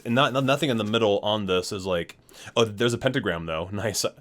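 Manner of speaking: usual speaking voice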